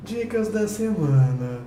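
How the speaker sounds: slow, nasal jingle announcer voice; sing-song it through the nose, slowly. Shown as a demonstration. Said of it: "Tem que falar com o nariz". (voz anasalada e lenta de vinheta)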